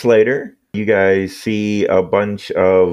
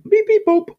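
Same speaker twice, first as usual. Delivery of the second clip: very high electronic beeps; beeping. Acted. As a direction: chirp it like a communicator. GM imitates communicator beep.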